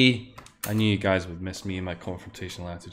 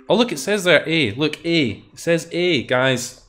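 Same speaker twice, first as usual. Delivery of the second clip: excited; 6 semitones higher, 5 dB louder than usual. Real, not acted. Excited discovery of the name.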